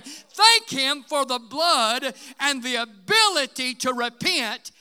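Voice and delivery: loud, rising sermon cry building on each line; preaching at full force. Acted. Preacher crying out to his congregation about the cross, full-force preaching.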